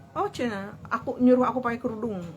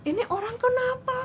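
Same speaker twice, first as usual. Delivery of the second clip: much higher, incredulous question; bewildered disbelief; 14 semitones higher than usual. Retold or imitated. Woman reacting to money thrown at her, bewildered. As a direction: ask it as if he's lost his mind.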